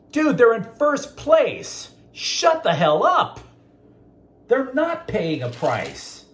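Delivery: slow, high, exasperated outburst; exasperated rebuke. Acted. Host telling off a columnist, loud and exasperated. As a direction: push it high and spell it out slowly, fed up.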